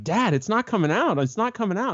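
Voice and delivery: put-on high-pitched child pestering Dad; nagging kid. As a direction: imitate a kid's insistent running commentary. Son nagging dad, retold.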